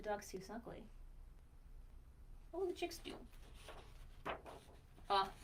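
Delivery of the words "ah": plain reading voice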